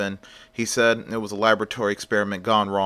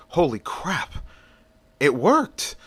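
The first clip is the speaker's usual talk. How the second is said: higher-pitched acted astonishment; amazed; 5 semitones higher, slower than usual. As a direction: marvel at your own success, voice rising. Inventor sees his fist glow.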